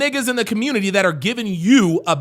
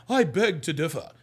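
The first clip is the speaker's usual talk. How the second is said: softer, lower mock-proper quote; mock proper talk; 5 semitones lower, 6 dB quieter than usual. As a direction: imitate someone talking too correctly. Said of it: "you don't talk like that".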